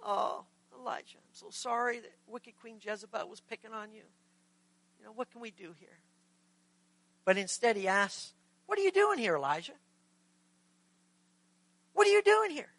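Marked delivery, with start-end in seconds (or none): 0.0-6.0 softer, cooing, fading to near nothing; mock sympathy. God pretends to coddle Elijah after Jezebel, soft and cooing. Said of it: "you would think God would be all sympathetic". 8.7-12.7 high, pointed repeated question; God confronting. Acted. God presses the fleeing Elijah with a high, pointed question.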